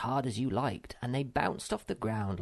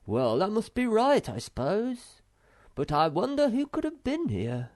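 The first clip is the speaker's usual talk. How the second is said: put-on higher, louder young troll, eager and bossy; a small troll taking charge of the pears; Moomin Troll voice; 12 semitones higher, 5 dB louder than usual. A narrator's reading.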